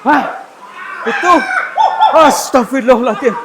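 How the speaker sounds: high, loud shout with a laugh; startled shout. Real, not acted. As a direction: blurt it out in a fright. Startled by something in the dark.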